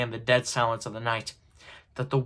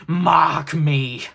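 loud, raised ghostly command; 5 semitones higher, 10 dB louder than usual. A narrator's reading. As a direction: demand attention with a sudden swell. Ghost demands Scrooge's attention.

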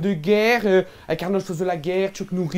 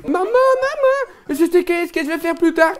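put-on much higher, slow child calling mum; excited child; 7 semitones higher than usual. Little boy tells his mother his plan, excited child voice.

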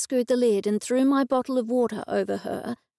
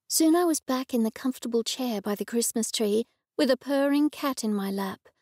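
ordinary delivery, nothing marked

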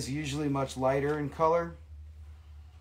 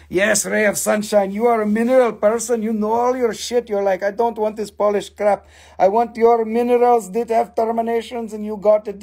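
excited praise; 7 semitones higher, 8 dB louder than usual. Real, not acted. Loud teasing praise of viewer.

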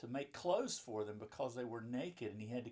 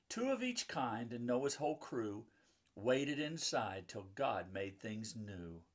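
lively rhyming read-aloud, a bit louder; reading to children; 5 dB louder than usual. A narrator's reading. Mom reading a Bible picture book to children, lively rhyming read-aloud. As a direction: bounce the rhymes for the kids.

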